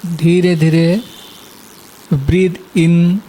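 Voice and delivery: guided meditation. Acted. Teacher guiding listeners to relax and inhale, guided meditation.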